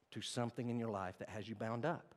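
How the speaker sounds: usual speaking voice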